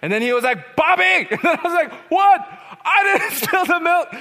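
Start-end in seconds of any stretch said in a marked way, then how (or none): 0.8-1.3 very high, loud shout of the name; full-voiced imitation of dad bellowing; enraged father's yell. Enraged father yells child's name. 2.1-4.1 put-on very high, loud childlike protest; hammed-up frightened kid yelling back; panicked denial.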